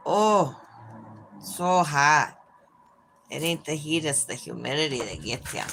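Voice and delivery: a hint of thick Minnesotan accent. Shown as a demonstration. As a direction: say it like a folksy Minnesotan. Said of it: "how do I say that in Minnesotan?".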